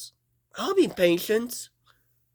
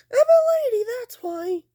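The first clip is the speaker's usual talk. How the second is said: very high, louder, squealing protest; squeaky lady voice; 4 dB louder than usual. Pretend. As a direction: squeal it like a prissy pretend lady. Toy character insists indignantly.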